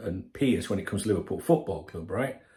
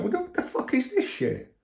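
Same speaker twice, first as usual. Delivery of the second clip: incredulous; 14 semitones higher than usual. Retold or imitated. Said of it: "'cause I'm like".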